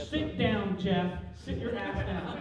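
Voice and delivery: loud, playful barking at the crowd; pretend-stern loud announcement; mock commanding. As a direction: order them about at full volume, in jest. Mock ordering an audience member to sit.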